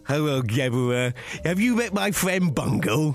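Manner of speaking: slow, drawn-out character greeting; Bungle impression. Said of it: "here's the first one".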